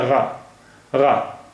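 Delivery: गा with a gargled, rubbing throat sound, not a hard g; soft throaty g. Shown as a demonstration. Teacher explaining the dotted letter, gargled गा. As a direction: let गा rub softly in the throat. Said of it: "नीचे बिंदी रख दी गई है".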